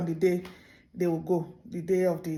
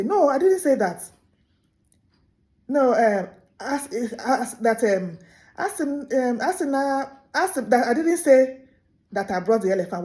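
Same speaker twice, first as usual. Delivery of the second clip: loud, higher, hesitant denial; flustered denial; 5 semitones higher, 5 dB louder than usual. Acted. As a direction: deny it hastily, stalling. Tortoise denying, gaslighting elephant.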